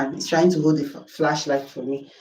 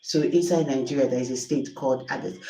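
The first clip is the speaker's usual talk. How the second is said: ordinary talk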